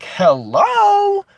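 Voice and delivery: very high, slow, loud drawn-out "hello"; incredulous hello. Real, not acted. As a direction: stretch it out in disbelief. Ranting at the media.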